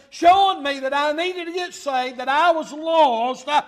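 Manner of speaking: loud, driving pulpit delivery; preaching. Real, not acted. Preaches at full force.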